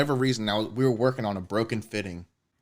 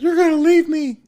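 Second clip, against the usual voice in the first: much higher, louder whiny plea; mock girlfriend fearing; 10 dB louder than usual. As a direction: imitate her tearful fear of being left. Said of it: "She's like".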